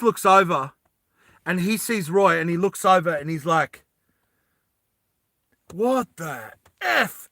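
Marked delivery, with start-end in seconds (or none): 5.7-7.3 drawn-out, high outraged exclamation; slow incredulous outrage; Vas swearing. Acted. Vas spots Roy taking supplies, outraged disbelief. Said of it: "he just swears".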